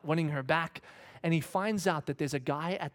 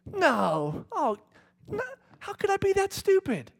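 much higher and louder dismay; self-reproach; 6 dB louder, slower than usual. Retold or imitated. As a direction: berate yourself in shock. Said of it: "the kind that just kinda went".

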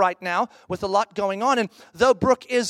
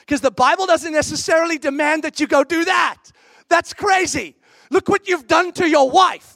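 higher, louder shouted accusation; imagined rebuke; 6 semitones higher, 4 dB louder than usual. Retold or imitated. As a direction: blast them for hurting the wife. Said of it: "what he was trying to say very nicely was".

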